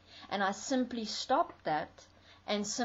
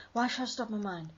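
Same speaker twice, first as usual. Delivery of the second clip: mock panic; much faster than usual. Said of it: "a lot of people will argue".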